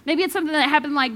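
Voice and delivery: normal talking voice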